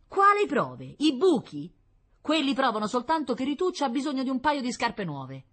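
high, loud, incredulous challenge; wife dismissing his evidence; scornful retort. A narrator's reading. Wife scornfully disputes husband's proof. (replica incredula e sprezzante)

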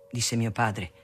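usual speaking voice

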